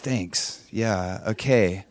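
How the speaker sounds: low, soft, reluctant reply; mock-deflated, quiet acknowledgement; underwhelmed acceptance. Chad hearing he was slandered, quietly underwhelmed. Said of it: "then he's feeling like".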